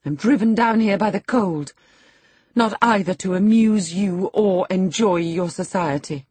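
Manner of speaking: high-pitched, haughty indignation; scornful tirade. A narrator's reading. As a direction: reject them all with proud contempt. Lady's scornful tirade at the household.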